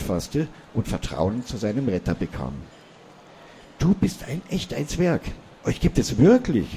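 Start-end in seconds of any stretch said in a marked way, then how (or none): 3.8-6.7 high, breathless disbelief, a little louder at the end; he can't believe a dwarf is real; boy stammering in astonished disbelief; stunned disbelief. A narrator's reading. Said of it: "fragte Peter fassungslos".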